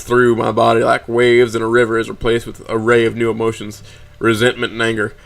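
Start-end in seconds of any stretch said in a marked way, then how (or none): none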